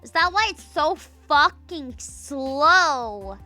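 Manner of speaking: usual speaking voice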